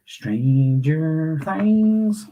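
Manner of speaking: high, very slow, stretched-out title; playful drawn-out echo. Pretend. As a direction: draw the title out, rising high.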